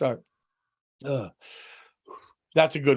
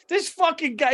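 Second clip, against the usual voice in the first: mocking coworker; 10 semitones higher than usual. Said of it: "he goes".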